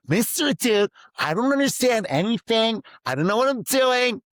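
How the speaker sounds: high, loud melodramatic student wail; mock whiny student. Student to teacher Mister Dude, hammed-up high whine. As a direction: play the overwhelmed kid, hamming up the despair.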